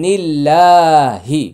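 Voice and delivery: drawn-out recited word; slow recitation. Shown as a demonstration. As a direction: recite it slowly and clearly. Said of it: "slow recitation of word". (ধীরে তিলাওয়াত)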